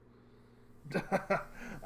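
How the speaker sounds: bright teasing chuckle; high playful laugh. Acted. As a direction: laugh off the prank.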